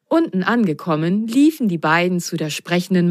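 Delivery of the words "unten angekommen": usual speaking voice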